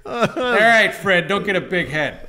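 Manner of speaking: loud, laughing mock outrage; mock indignant shout. The offended soldier yelling at Karnas, loud laughing mock outrage. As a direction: yell it like an offended husband, laughing.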